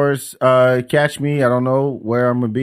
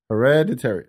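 normal talking voice

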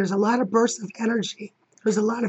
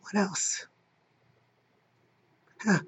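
normal talking voice

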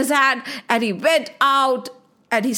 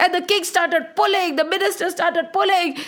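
plain reading voice

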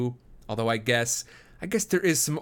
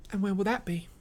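put-on higher, bashful young woman; shy laughing girl; 9 semitones higher than usual. A narrator's reading. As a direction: ask it coyly, with a blush. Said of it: "she asked, blushing and laughing".

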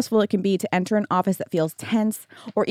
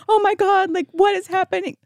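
panicked mom; 9 semitones higher than usual. Retold or imitated. Mom panicking over arrest call.

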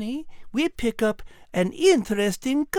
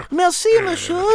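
put-on French accent; 5 semitones higher, 5 dB louder than usual. French cat character.

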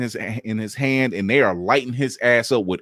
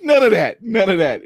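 higher, louder and insistent; emphatic; 8 semitones higher, 3 dB louder than usual. Real, not acted. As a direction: stress NONE both times. Emphatic denial.

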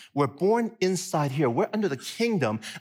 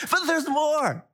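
higher, bigger TV-ad pitch; infomercial voice; 10 semitones higher, slower than usual. Acted. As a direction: push the hype even further. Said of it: "I sound like an infomercial".

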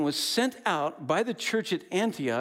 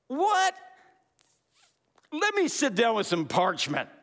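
mock fired-up Paul; 8 semitones higher, 4 dB louder than usual. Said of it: "he got fired up angry".

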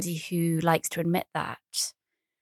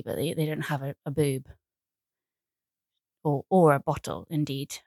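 ordinary delivery

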